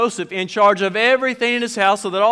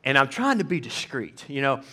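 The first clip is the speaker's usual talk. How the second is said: normal talking voice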